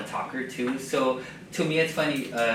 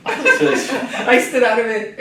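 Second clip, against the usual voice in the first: laughing; 10 semitones higher, 11 dB louder, much slower than usual. Real, not acted. Still laughing at story.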